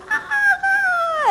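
very high imitated panic wail; mock collective freak-out; panicked cry. Trainer imitating a class panicking at a crisis, high mock wail. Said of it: "he yelled out some crisis ... everybody went".

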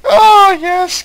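high, loud, hammed-up cry of pleasure; mock ecstatic cry. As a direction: wail it out in mock rapture. Mock ecstasy over game scene.

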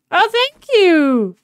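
loud, high, slow gushing thanks; effusive thanks. Real, not acted. Player thanks a teammate for a gift, effusive. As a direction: draw it out loudly with delight.

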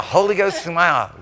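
ordinary delivery

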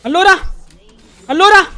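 high, loud mocking yell; loud taunting call. Streamer taunting a friend with a loud high yell. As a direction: taunt him loudly and high.

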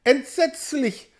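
high, slow cry of horror; a lone woman crying out in dread; horrified cry. A narrator's reading. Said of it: "schrie plötzlich eine einzelne".

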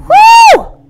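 very high loud falsetto whoop. Pretend. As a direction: let out a wild whoop. Whoop after singing.

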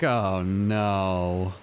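ordinary delivery

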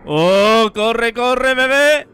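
yelling in panic while fleeing; being chased. Real, not acted. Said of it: "Yo he corrido porque decía, "Corre".

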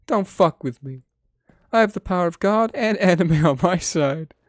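high, grandiose anime declaration; hammed-up boast. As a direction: declaim it like a smug anime hero. Quoting anime meme boast.

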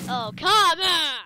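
loud, drawn-out cry of protest; exasperated shout. Real, not acted. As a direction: shout it in frustration at the game. Losing a moment while explaining game.

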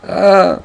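short high loud scream; a shot man screams. Acted. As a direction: scream cry of pain. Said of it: "Suddenly a scream is heard".

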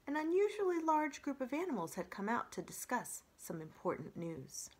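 usual speaking voice

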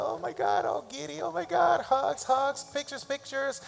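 high, giddy squeals over babies; all giddy, squealing oh my God; giddy gushing. Retold or imitated. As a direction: gush like a delighted new grandma. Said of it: "she's like".

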